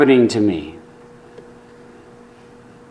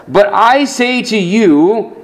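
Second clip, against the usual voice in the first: slow, weighty, higher declaration; emphatic; 5 semitones higher than usual. Retold or imitated. Preacher voicing Jesus's warning, emphatic and slow. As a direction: lean hard on every word as Jesus. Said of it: "in, in the emphatic".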